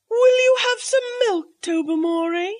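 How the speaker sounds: hostess suggesting to her guests, high and bright; Lady Blemley's voice. A narrator's reading.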